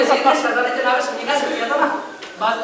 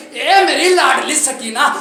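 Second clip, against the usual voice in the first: tearful plea; 4 semitones higher, 5 dB louder than usual. Acted. The Prophet pleading with his beloved Sakina, loud and tearful. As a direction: beg her not to tell it, near tears. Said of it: "رسول کی آواز آئی".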